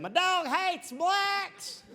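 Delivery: slow dumb southern voice; hillbilly voice. Retold or imitated. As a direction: play the clueless bigot. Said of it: "I knew guys like".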